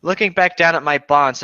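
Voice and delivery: ordinary talk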